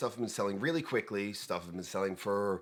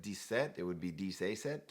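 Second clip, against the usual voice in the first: a soft, half-hearted French accent on "dix-sept", said "tiseɪsɛt"; bad French accent; 3 semitones lower, 7 dB quieter than usual. Shown as a demonstration. Host trying out French number words in a bad French accent. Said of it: "That was a really bad French accent".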